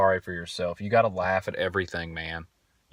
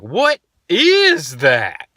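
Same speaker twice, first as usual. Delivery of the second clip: put-on higher, louder, slow child's question; imitating little daughter; 8 semitones higher, 8 dB louder, much slower than usual. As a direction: ask it slowly like a puzzled toddler. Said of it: "My daughter would probably do something and be like".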